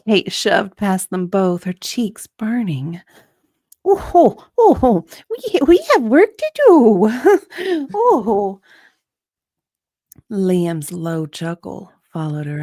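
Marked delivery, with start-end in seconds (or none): 3.8-7.9 high, loud, fast fluster; flustered Kate. Acted. Kate shoving past them, high flustered blurt. 7.9-8.6 high giggling sigh; flustered giggle. Acted. Kate escaping embarrassed, nervous giggle.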